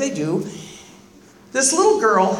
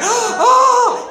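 a loud, high shocked oh; startled gasp; 11 semitones higher, 5 dB louder than usual. Retold or imitated. The room reacting to a car through the wall, high loud shock.

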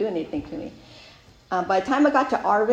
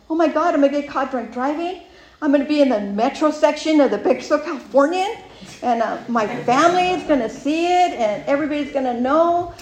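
panicky; 6 semitones higher, 5 dB louder than usual. Retold or imitated. Spiraling fear of getting caught.

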